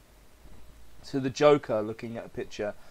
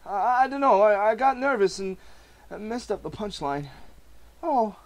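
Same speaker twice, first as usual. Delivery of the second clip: higher, stammering and distraught; husband breaking down to his pregnant wife; nervous failing comedian; 13 semitones higher, 10 dB louder than usual. Acted. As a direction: crack under the worry.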